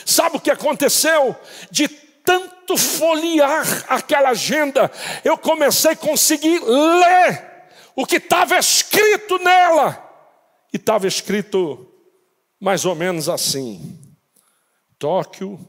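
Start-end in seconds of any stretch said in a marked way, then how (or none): 0.1-10.1 loud, higher, slow preaching climb; preacher building to a shout; fervent preaching. Acted. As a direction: climb toward the revelation.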